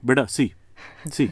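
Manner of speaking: a hint of a lecturing uncle; mock Indian uncle scolding his son; Indian uncle accent. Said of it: "an Indian uncle will say stuff like".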